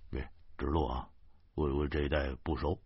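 low, soft, hesitant request; hushed, sheepish. A narrator's reading. Driver quietly asks his passenger for directions, sheepish. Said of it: "不得不小声地对我说".

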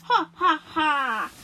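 slow, loud acted ha-ha-ha; big deliberate storybook laugh. A narrator's reading. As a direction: laugh slowly, each ha drawn out. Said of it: "乌云笑着说".

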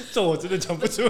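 high, loud words through laughter; laughing. Real, not acted. Guest can't bring himself to say it, laughing. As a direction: struggle to get it out while laughing. Too amused to say it.

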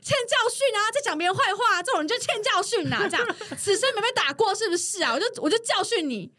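hammed-up righteous fury at the coworker; retold angry rant. Narrator replays yelling at the rude coworker, mock angry. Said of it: "我就说".

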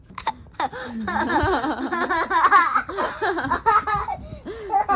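long, high, loud laughter; laughing. Real, not acted. Listener cracking up at the bear's pleas, laughing. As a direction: burst into helpless giggles. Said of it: "你在笑什么".

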